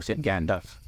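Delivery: usual speaking voice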